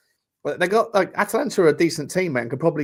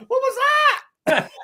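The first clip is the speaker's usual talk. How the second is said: very high, squeaky laughing words; barely holding back the giggles; cracking up. Real, not acted. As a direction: squeal it through real laughter. Cracking up at the accent.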